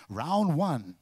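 ring announcer call. Acted. Girl with number board.

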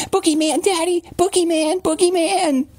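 very high squeaky imitation of a frightened toddler; thin, high-pitched child's cry; wailing small child. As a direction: yowl it like a terrified little girl, falsetto. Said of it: "starts to yowl and scream and cry".

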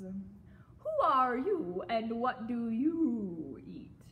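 very slow, drawn-out question; crocodile voice. A narrator's reading. Crocodile questions frog she may eat, slowly. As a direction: stretch it out like a lazy predator.

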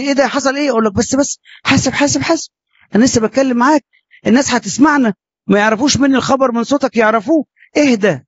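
high, hurried hush to keep others from hearing; urgent hushed pleading. Retold or imitated. A father hushed urgently so neighbours won't hear.

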